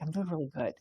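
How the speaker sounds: quiet, understated sincerity; sincere. Acted. Showing the class what truly meaning it is like, quietly sincere. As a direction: drop the front and say it softly. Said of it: "is really like".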